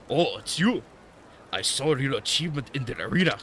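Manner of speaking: hammed-up mock-Japanese old master; Temu Japanese accent. Old master greeting the arena winner, bad Japanese accent. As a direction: greet them in a badly faked Japanese accent. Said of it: "doing some Temu Japanese accent".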